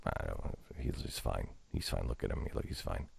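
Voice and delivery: hushed, fading under his breath to a whisper; muttering to himself. Retold or imitated. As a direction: talk yourself out of it, nearly voiceless. Muttering to himself, hiding panic.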